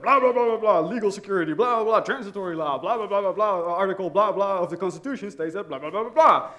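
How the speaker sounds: mock rapid-fire legal lecture; imitated fast talking. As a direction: rattle it off in a run-on stream. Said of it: "she starts talking really fast".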